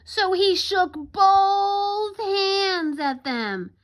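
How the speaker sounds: high, slow, stretched storytelling; dramatic narration. A narrator's reading. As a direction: draw it out to build the anger. Narrator builds the peddler's anger.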